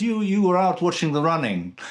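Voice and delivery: put-on Russian accent, pressing the complaint. Hairdresser complaining to client who ignored him, imitated Russian accent. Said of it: "I can only do a Russian accent".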